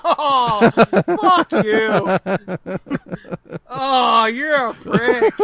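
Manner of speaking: real laughter through the insult; laughing retort. Real, not acted. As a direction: curse back at a teasing friend, laughing. Teased about diapers, laughs back.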